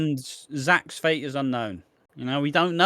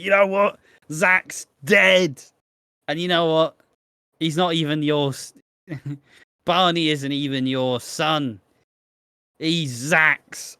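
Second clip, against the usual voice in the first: mock scream; 2 semitones higher than usual. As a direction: yell the secret out at the family. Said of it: "she's screaming".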